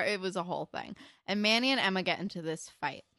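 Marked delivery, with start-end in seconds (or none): none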